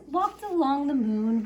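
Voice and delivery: ordinary delivery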